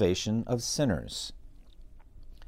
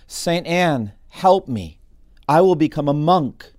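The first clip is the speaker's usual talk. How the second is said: higher, louder narrated plea; mild cry of terror; 6 semitones higher, 8 dB louder, slower than usual. A narrator's reading. Young man in a storm calling on a saint, mildly terrified cry. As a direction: cry out for help, a touch terrified. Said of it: "he cried out in terror".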